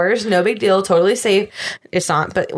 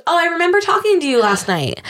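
higher-pitched mock hotel clerk; imitated clerk, pitched up; 7 semitones higher than usual. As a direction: quote the clerk in a raised, mocking pitch. Quoting the hotel clerk who cancelled.

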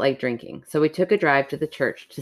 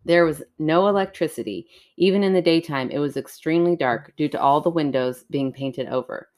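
story narration; 2 semitones lower than usual. Acted. Reads a creepy story aloud.

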